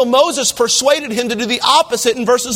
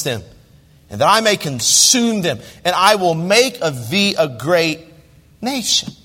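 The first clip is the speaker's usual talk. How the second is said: lower, measured reading of King James verses; scripture reading; 4 semitones lower than usual. Acted. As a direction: read the verses like holy writ. Reads scripture aloud in sermon.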